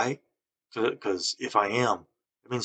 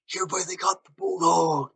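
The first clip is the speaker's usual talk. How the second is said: imitating coworker; 10 semitones higher, 4 dB louder than usual. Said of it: "he goes".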